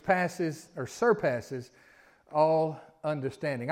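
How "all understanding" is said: ordinary delivery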